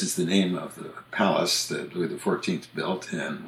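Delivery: usual speaking voice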